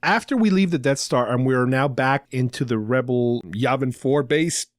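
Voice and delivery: ordinary talk, nothing marked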